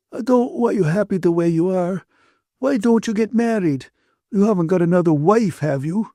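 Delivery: high-pitched tearful pleading; crying. A narrator's reading. Ferguson cries and urges the couple to marry, tearful. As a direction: plead through acted tears. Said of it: "Ferguson cried again".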